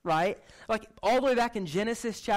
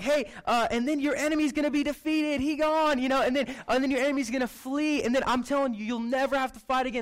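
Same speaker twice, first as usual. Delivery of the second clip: higher, louder pep rally promises; hyped prophet; 6 semitones higher, 3 dB louder than usual. Retold or imitated. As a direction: pump the crowd up. Isaiah hyping the people.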